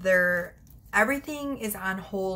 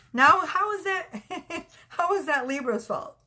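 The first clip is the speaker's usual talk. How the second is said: laughing disbelief; 8 semitones higher than usual. Real, not acted.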